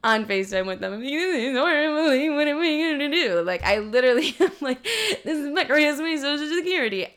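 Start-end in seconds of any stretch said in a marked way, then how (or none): 1.1-3.4 high mock wail, words running together; exaggerated tearful whine; imitated bawling. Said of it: "that's how I'm talking". 4.8-7.1 very high blubbering squeal; mock hysterical wail; imitated bawling. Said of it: "that's how I'm talking".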